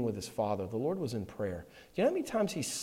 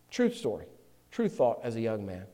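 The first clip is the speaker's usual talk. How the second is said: ordinary talk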